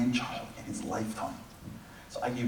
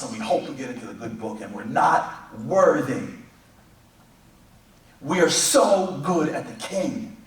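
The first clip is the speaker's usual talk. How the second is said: preaching at full force; 7 semitones higher, 10 dB louder than usual. Acted. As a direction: preach it big to the room.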